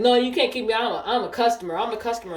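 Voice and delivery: acted insistence, loud then easing; imitating protesting customer. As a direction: argue back as the indignant customer. Retold customer protests being thrown out.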